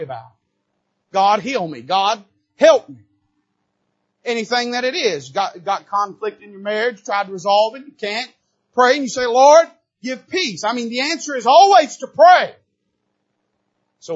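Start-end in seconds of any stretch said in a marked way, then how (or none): 1.1-12.5 loud, high mock prayer cries; pleading loudly like a prayer meeting; preaching. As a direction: cry out the prayers with preacher's force. Preaching at full force.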